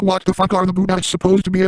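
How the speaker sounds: ordinary delivery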